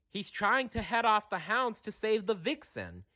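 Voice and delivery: high soft bird voice. A narrator's reading. Kestrel voice.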